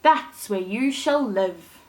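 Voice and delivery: gentle storybook reading voice; storyteller reading a fairy tale to kids; story narration. A narrator's reading. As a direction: read it like a bedtime story for children. Reads Thumbelina story aloud.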